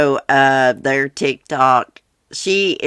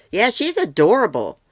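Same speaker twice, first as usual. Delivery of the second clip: ordinary delivery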